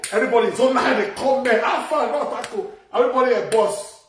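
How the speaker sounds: loud, high rallying call to the crew; shouting orders to the crew, rallying. Real, not acted.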